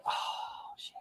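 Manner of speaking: a soft whispered curse. Retold or imitated. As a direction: mutter it under your breath, caught out. Missed the highway cutoff while driving.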